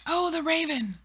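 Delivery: ordinary delivery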